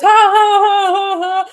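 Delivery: loud, high mock bawling; mock wailing sob. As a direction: wail out exaggerated sobs, very loud. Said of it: "and just cries".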